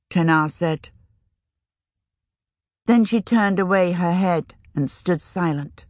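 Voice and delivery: ordinary delivery, nothing marked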